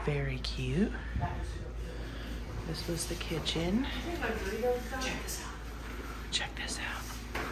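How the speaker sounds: hushed whisper. Real, not acted. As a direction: whisper so nobody hears. Whispering in quiet house.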